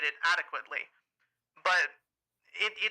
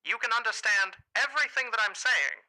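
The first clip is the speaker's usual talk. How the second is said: pinched mid-range announcer accent; old radio voice. Shown as a demonstration. Host demonstrating to cohost in old radio accent. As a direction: explain the voice while staying in it. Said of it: "I gotta speak like this".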